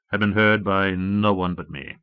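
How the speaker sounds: usual speaking voice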